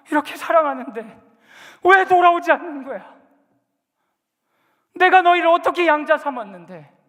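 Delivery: fiery sermon climax; preaching at full force. Acted. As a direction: thunder God's anguished warning. Preaching as God.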